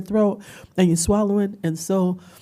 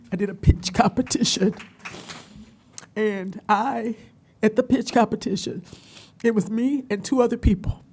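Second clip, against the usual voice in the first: choked up, higher voice with halting pauses; real emotional; 5 semitones higher than usual. Real, not acted. Recalling the pitch competition, real tearful voice. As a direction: push on through rising tears, pausing between phrases. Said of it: "Sorry, guys, I'm".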